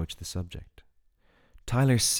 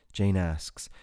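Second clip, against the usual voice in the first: ordinary delivery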